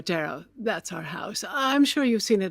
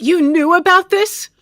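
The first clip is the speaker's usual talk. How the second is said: high, loud accusing cry; shocked outburst; 10 semitones higher, 9 dB louder than usual. Acted. Widow shouting at the attorney who kept the secret. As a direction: hurl it at him, appalled.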